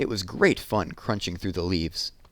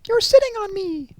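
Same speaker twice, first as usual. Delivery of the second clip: tiny squeaky bear voice; little muffled voice; 6 dB louder than usual. Acted. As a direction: squeak it from under the leaves. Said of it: "said a little muffled voice".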